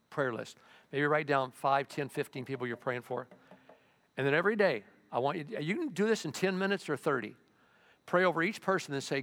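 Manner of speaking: ordinary talk, nothing marked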